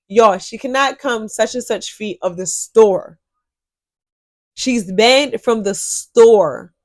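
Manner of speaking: slow, drawn-out words for emphasis; emphatic slow repetition. Real, not acted. Telling viewers she's banned from the store, emphatic slow repetition. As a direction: spell it out slowly, stretching the key words.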